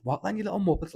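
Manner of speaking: high, loud taunting yell; cocky challenge. Retold or imitated. A lightly voiced kid taunting the chasing gang in the dream, mock yell. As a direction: bark it at the gang like a hard kid. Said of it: "this kid comes out of nowhere and goes".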